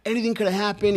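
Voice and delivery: high-pitched retold chewing-out; hammering them, acted out; mock scolding. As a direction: imitate a supervisor tearing into the crew, volume held back. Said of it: "He fucking just hammered those guys".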